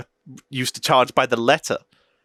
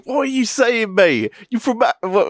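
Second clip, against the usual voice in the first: high, confrontational London chav voice; mock chav London bloke, pitched high; London chav accent; 10 semitones higher than usual. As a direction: challenge them in a London accent. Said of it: "the London style voice".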